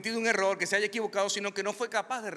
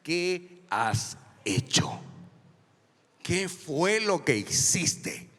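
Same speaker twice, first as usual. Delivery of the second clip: solemn God; 4 dB louder, much slower than usual. Acted. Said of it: "en el original es".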